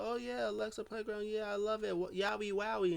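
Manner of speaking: high mock-enthusiastic chatter; imitated eager fan. Asuka gushing about Alexa Playground, high mock enthusiasm. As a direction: pitch up in playful gushing imitation. Voicing excited fan in a retelling.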